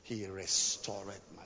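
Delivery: soft, hushed reading of the psalm, fading to a whisper; soft scripture reading. Acted. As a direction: read it gently, like a lullaby over the music. Reading psalm over music.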